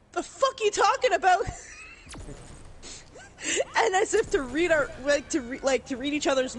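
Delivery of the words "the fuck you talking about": high, louder disbelieving snap; a mock baffled outburst at the husband; incredulous retort. Wife and I react to the husband's odd request, incredulous. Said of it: "we're like".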